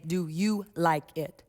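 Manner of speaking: put-on slow, soft, inviting question to a child; teacher gently asking a child about their painting; gentle question. Shown as a demonstration. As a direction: ask it kindly, slowly, giving the child time. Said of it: "I will say gently".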